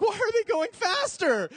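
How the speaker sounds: high, slow panicked cry; panicked child running after car. Retold or imitated. Child chasing parents' car, panicked high cry. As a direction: plead as the car pulls away. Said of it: "you're like".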